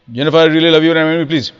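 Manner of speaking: hurried robotic proposal without accents; wrong tempo, no modulation. Shown as a demonstration. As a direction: gabble it in one breath on one note. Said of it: "No accent, no modulation, wrong tempo".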